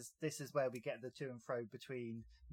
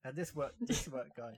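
ordinary delivery

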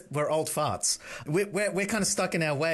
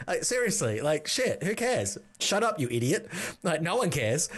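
normal talking voice

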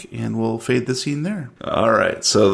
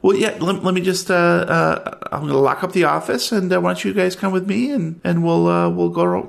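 high, eager clerk voice, a bit fast; chirpy office clerk; 8 semitones higher than usual. Acted. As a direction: play the fussy storage clerk, all smiles.

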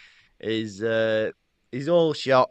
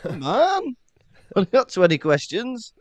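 mock impatience; 8 semitones higher, 5 dB louder than usual. Mocking Harry's questioning.

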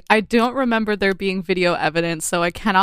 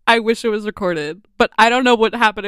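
ordinary talk, nothing marked

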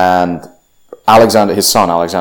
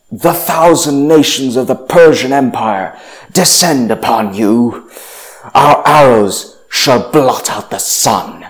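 higher, louder and slow, a grand threatening herald; menacing emissary; 5 semitones higher, 4 dB louder than usual. Retold or imitated. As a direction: boom it out like an arrogant Persian envoy. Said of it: "the emissary says".

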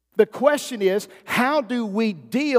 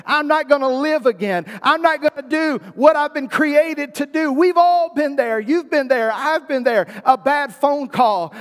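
loud, high, impassioned preaching; preaching at full force; 4 semitones higher, 4 dB louder than usual. Acted. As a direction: build it up and preach it out to the church.

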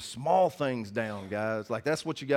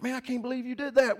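much higher dazed complaint; stunned disbelief; 11 semitones higher than usual. Retold or imitated. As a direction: whine it like a kid just knocked down. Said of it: "he was like".

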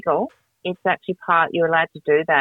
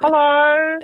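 high, sung-out mock hello; phone greeting; 10 semitones higher, 4 dB louder than usual. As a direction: call out a playful hello down the phone. Mimes ringing people up.

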